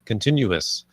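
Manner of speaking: ordinary talk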